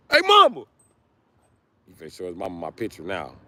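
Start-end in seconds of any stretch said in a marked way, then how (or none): 0.1-0.6 high greeting. Acted. Greeting his mother in a high sing-song. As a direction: sing out a high hey mama. Greeting someone's mom on camera.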